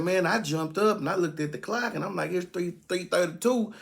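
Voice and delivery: high worried Joe. Retold or imitated. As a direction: voice Joe as he spills his night story.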